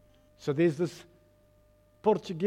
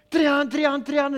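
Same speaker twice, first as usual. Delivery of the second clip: high, loud repeated barking; shouted army commands; 8 semitones higher, 4 dB louder, much slower than usual. Retold or imitated. Army shouting at scurrying recruits, urgent high shouts. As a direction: imitate sergeants yelling over each other. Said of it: "so they're shouting".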